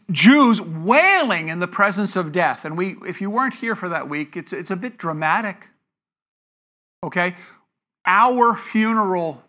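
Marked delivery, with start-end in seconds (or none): none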